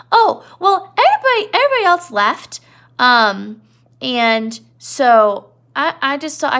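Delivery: put-on a big kid whining innocently; Lenny. Teacher voicing Lenny explaining why he came, high and childlike. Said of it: "He's like".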